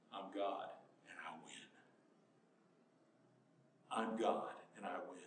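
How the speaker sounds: very low, near-whispered; quiet certainty, spoken as God; hushed divine assurance. Acted. Voicing God, hushed then repeated.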